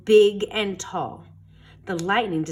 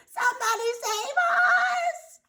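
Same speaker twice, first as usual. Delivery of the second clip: much higher, slow panicked cry for help; frightened cry. A narrator's reading. Chester under the monster's foot, crying out high. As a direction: plead like a kid about to be stepped on. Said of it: "cried Chester".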